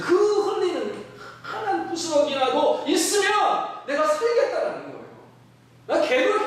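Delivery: rising, high, fervent sermon voice; impassioned preaching. Acted. Pastor urges the congregation toward crumb-sized faith, impassioned preaching.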